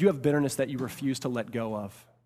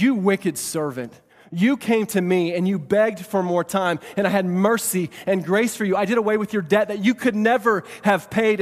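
raised voice, building outrage; indignant rebuke; 7 semitones higher, 8 dB louder than usual. Acted. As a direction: condemn the servant as an angry king. King rebukes wicked servant.

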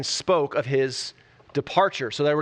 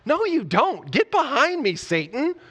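high, firm rebuke; mock stern correction, pitched up; Jesus rebuking; 10 semitones higher, slower than usual. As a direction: shut him down flatly. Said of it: "Jesus is like".

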